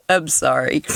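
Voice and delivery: apologizing through the tail of a laugh; laughing apology. Real, not acted. Reader apologizes for laughing at own joke, still amused. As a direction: recover from a giggle to say sorry. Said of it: "I shouldn't be laughing".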